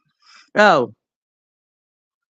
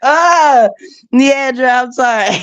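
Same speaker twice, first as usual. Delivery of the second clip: mock wail; 7 semitones higher, slower than usual. Hammed-up apology to Leandra.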